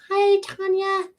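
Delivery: squeaky high slow welcome; very high greeting. Real, not acted. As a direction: greet her way up high and drawn out. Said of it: "voice goes so damn high".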